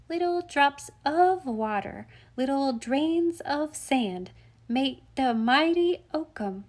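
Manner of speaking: put-on high, slow toddler reciting a verse; little child's recitation, stressing the wrong words; baby voice. A narrator's reading. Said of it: "in his baby voice, and putting the emphasis on the wrong words".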